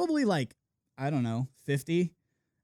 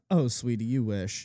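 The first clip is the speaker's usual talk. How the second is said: condescending; 11 semitones lower, slower than usual. Retold or imitated. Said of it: "as if to say".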